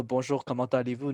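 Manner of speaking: "Bonjour, comment allez-vous?" in clean French, no English accent; native-sounding French. Shown as a demonstration. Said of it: "Notice how when I switched, there's no accent at all".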